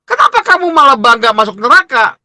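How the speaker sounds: high-pitched indignant question; acted stern challenge. God stops sinner, demands explanation. (teguran heran bernada tinggi)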